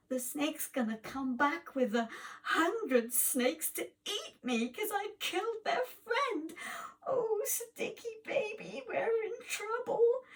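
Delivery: high, wailing frightened whine; cowering hero's high wail; whimpering fear. Acted. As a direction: whimper that you're doomed. Hero fears snakes return.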